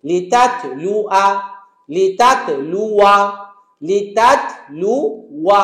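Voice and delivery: লিতাতলুয়া slowly, ending short on য়া; slow repeated recitation. Shown as a demonstration. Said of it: "শেষ".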